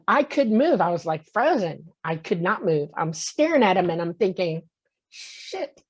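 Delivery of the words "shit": breathless whispered shit; whispered curse. Retold or imitated. Frozen in his room, staring at the beings, whispering. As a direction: hiss it under your breath in shock.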